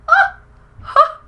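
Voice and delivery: very high, loud squeal of delight; excited shriek. Real, not acted. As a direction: shriek with joy at the packaging. Unboxing the highlighter, shrieks.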